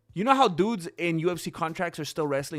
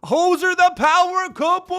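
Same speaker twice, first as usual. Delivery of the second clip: high, loud hype call; excited shout-out; 14 semitones higher, 9 dB louder than usual. Real, not acted. Streamer hails viewers joining the chat, excited shout-out. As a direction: belt it out like a hype man.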